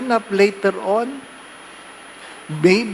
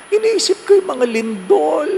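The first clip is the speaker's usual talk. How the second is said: a higher, plaintive complaint; lightly voiced worried parishioner; 10 semitones higher than usual. Parishioner telling the priest she can't sleep, mock plaintive.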